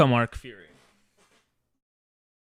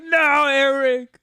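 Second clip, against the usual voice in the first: mock protest; 8 semitones higher, 6 dB louder, slower than usual. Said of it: "You're like".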